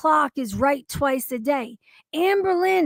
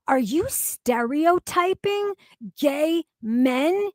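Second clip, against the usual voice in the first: ordinary delivery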